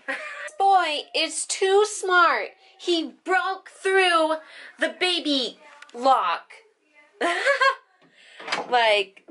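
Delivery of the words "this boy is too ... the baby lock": slow, amazed and high. Real, not acted. Baby broke the lock.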